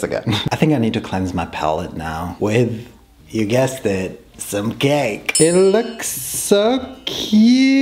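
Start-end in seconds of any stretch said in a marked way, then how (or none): 5.4-7.8 high, slow cooing voice; cutesy voice. Acted. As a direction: coo over it sweetly. Cooing over decorated cake.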